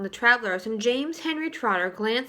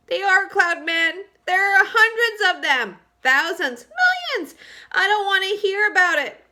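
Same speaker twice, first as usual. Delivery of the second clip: high, loud panicked shriek; the blind earthworm shrieking in panic at the cloud men; 9 semitones higher, 8 dB louder than usual. Acted. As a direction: cry it out in terror, pitched up. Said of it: "shrieked the old poor blind earthworm".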